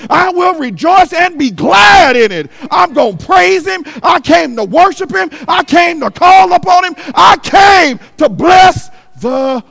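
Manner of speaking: loud, rising preacher's cadence; preaching at full force. Acted. Preacher rousing the church to praise, full force. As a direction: preach it out to the congregation.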